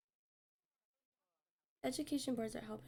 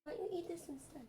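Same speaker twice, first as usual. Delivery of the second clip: higher coaxing pet voice; talking to a pet; 6 semitones higher, 3 dB quieter than usual. Real, not acted. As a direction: coax the cat gently, higher. Coaxing the cat.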